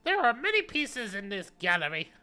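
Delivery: put-on high, loud old curator voice; old man voice. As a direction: speak as the crotchety old curator.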